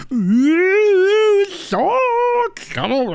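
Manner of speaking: high, loud wailing call to the sharks; high loud wail summoning sharks. Pretend. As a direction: croon out to the sharks like a sea creature. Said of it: "I just talk like this".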